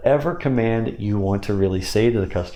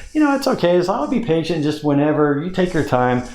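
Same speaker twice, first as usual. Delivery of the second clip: much higher and louder, easygoing; high patient reassuring voice; high pitch; 8 semitones higher, 3 dB louder than usual. Shown as a demonstration. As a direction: go way up high and relaxed. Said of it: "talk in a high pitch on this too".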